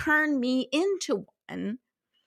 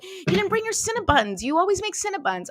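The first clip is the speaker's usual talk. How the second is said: high disappointed complaint; 8 semitones higher than usual. Retold or imitated. Complaining to another guest.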